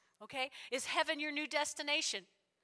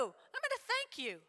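high excited promise; 12 semitones higher than usual. Acted. Praying man thanking God, excited. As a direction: lift it up with joy. Acted prayer.

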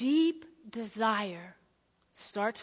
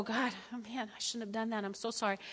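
soft, low-volume sorry prayer; quiet contrite plea; remorseful hush; 3 semitones lower, 12 dB quieter, faster than usual. Retold or imitated. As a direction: murmur it like a confession. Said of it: "you go".

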